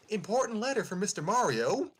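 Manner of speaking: high, cheery mailman voice. Acted. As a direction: announce it like a chirpy courier. Parakarry mailman voice.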